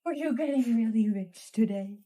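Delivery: playful loud tease. Acted. Teasing the pet, playful outburst.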